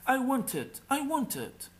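high, insistent whining demand. Acted. Spoiled princess insisting to her father the king, high demanding whine. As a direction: stamp your foot like a spoiled child.

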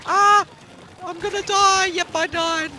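high loud yelling in panic; panicked scream. Real, not acted. Gamer about to be killed, panicked high scream. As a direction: shriek as you get taken out.

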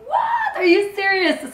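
shrieking very high what, amazed; shocked shout. Retold or imitated. Reacting to the captain's offer, astonished shout. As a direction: yelp in total disbelief.

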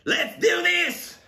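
high, drawn-out rallying call; psyched-up cry. Real, not acted. Vlogger kicks off his meal, psyched-up high call. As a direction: launch into it with a soaring pitch.

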